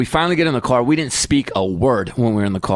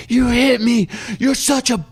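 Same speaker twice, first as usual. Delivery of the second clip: son's scream; 10 semitones higher, slower than usual. Retold or imitated. Hurt son yells at his dad, high wailing shriek. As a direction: wail it at dad for the whole patio. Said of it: "and he's screaming".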